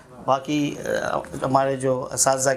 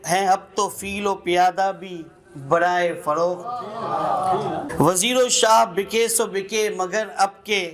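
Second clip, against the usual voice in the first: measured, lilting recital with repeated lines; poem recitation; 4 semitones higher than usual. Acted. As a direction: savour each line like a poet at a mushaira. Recites a nazm at a gathering. (नज़्म पढ़ने का अंदाज़)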